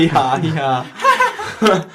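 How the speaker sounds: high braying hee haw; donkey bray. Acted. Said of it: "Like a donkey kind of thing".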